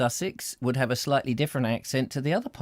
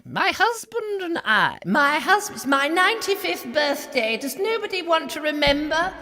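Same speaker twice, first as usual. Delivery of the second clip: put-on much higher, plummy elderly monarch lament; the Queen; 4 dB louder than usual. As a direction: play a lonely old queen begging for attention. Impersonating the Queen as old.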